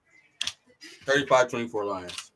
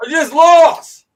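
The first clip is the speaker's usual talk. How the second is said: high, loud, slow wail of defeat; mock despair; 13 dB louder, much slower than usual. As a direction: dramatically pretend you've lost it all. Mock despair at Miami pick.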